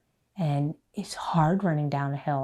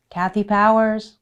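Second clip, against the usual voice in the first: called out name; 5 semitones higher, 9 dB louder than usual. Retold or imitated. Announcer calling her name.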